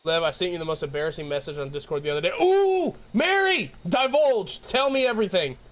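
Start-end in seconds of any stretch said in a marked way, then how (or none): 2.4-5.6 high-pitched, playful demand for juicy details; pry it out of a friend with mock urgency; eager gossip.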